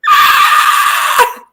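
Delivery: sudden very high unvoiced shriek; imitated scream. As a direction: shriek like the startled sister. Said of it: "she was like".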